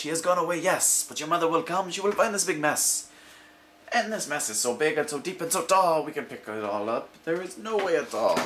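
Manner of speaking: high, fussy worried fish; a fish despairing over the mess; fretting fish. Acted. The fish lamenting the mess to the children, high and fretting.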